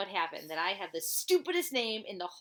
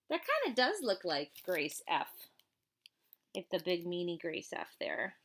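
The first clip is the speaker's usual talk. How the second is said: ordinary delivery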